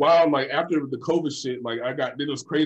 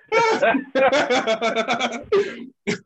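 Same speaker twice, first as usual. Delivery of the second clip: loud, high burst of laughter; 10 semitones higher, 5 dB louder than usual. Real, not acted. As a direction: laugh hard at your own story. Laughing at own story.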